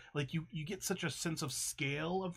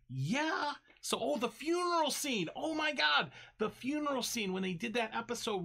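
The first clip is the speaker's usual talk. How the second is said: excited; 8 semitones higher than usual. Real, not acted. Gushing over favorite scene.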